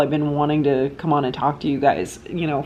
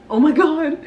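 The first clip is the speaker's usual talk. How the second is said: high, loud Oh my God; pained yelp; 10 semitones higher, 4 dB louder than usual. Real, not acted. Hair caught in the cello bow, a high loud yelp. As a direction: yelp it as the hair gets pulled.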